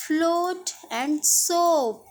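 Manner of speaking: फ्लोट and सोप said slowly and drawn out, stressing सोप; सोप with a falling end; slow model words. Shown as a demonstration. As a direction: draw out फ्लोट and सोप for the class. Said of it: "इसका ये pronunciation ही है".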